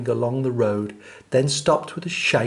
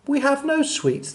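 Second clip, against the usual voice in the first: high, small chorus-like voice; tiny ants; 12 semitones higher than usual. Acted. Ants answering the rat in a tiny high voice.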